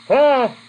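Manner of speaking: high, loud gasp of fright; mock startled cry. Bird sees two big eyes, mock startled cry. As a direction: play a bird shocked into a yelp.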